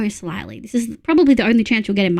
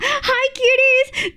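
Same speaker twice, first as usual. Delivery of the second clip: high, slow cutesy greeting; cute anime streamer; 13 semitones higher, much slower than usual. Acted. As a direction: squeal a sweet hello to fans.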